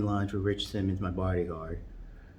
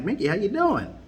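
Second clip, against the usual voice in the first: high, louder phone greeting; cheery greeting; 11 semitones higher, 4 dB louder than usual. Retold or imitated. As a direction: greet an old buddy.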